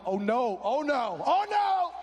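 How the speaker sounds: indignant, climbing to a high loud last cry; hammed-up outrage, building with each repeat; mounting indignation. Preacher replays seeing the rock fly in, mounting indignation. Acting out reaction to thrown rock.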